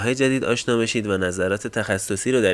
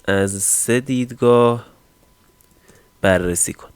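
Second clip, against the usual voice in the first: slow dictation; 4 dB louder, slower than usual. Real, not acted. Typing a prompt while saying it.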